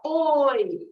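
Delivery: loud calling oi; shouting from far. Shown as a demonstration. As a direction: call oi across a distance. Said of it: "you're shouting from far".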